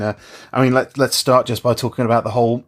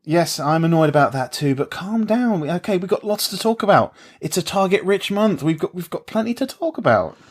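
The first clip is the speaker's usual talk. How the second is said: overexcited; 6 semitones higher than usual. Acted. Mock overexcited pep talk.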